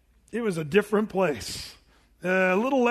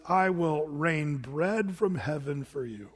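slow solemn quote; 4 semitones lower, slower than usual. Retold or imitated. Preacher reading God's promise to the people, slow and reverent. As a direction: let each word of grace land slowly.

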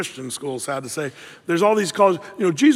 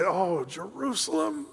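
soft, quiet, slow lament over the city; weeping lament; 9 dB quieter, slower than usual. Retold or imitated. Jesus weeping over the city, quiet grieving lament. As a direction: sigh it out, grieving and hushed. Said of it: "suddenly start weeping and say".

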